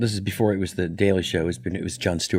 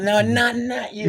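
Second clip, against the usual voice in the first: Martin Short impression; 13 semitones higher, slower than usual. Short running out joke.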